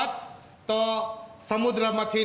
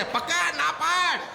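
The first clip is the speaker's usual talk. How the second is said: high, slow insistent prompting; urgent prompt; 7 semitones higher, slower than usual. Retold or imitated. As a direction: push the boy to refuse. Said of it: "sister કે".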